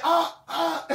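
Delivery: high, loud mock moans; hammed-up imitation of her moaning. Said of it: "she's like, she's like".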